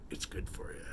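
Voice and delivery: soft creepy whisper. Acted. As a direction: hush it out like a secret. Spooky sign-off.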